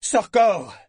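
loud, high call for the lieutenant; shout. A narrator's reading. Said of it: "inspira profondément et cria".

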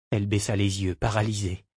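usual speaking voice

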